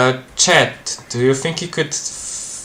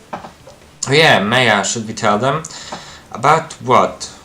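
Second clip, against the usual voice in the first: usual speaking voice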